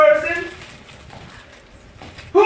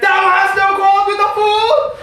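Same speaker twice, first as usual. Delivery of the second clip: louder, higher, laughing; laughing shout; 5 semitones higher, 4 dB louder than usual. Acted. Question repeated louder, laughing.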